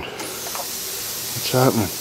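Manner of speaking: whispered very slowly, under the breath. Real, not acted. Wondering at the cutter in a slow whisper. Repeats line, second drawn out.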